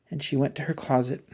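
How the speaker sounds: plain reading voice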